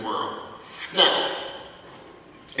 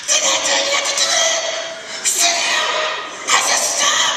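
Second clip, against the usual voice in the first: mock scolding teacher, much higher and louder; yelling teacher; 7 dB louder than usual. Imitating a teacher yelling at her class, high mock scolding. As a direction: shriek at the class like a fed-up teacher. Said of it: "I go to classrooms and I watch teachers".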